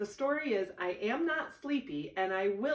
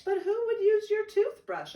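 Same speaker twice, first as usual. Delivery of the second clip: much higher and louder, incredulous; big brother baffled by the excuse; older brother asking in disbelief; surprised big brother; 13 semitones higher, 7 dB louder than usual. Acted.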